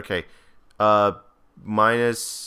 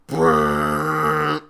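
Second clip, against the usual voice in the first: long, higher buzzing blare; imitated trailer horn; 4 semitones higher than usual. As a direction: hold a rolling movie-trailer braam. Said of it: "you would hear, like, a".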